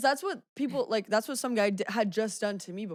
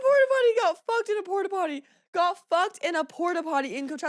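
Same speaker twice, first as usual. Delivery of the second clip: laughing; 7 semitones higher than usual. Real, not acted. Cracking up over the story.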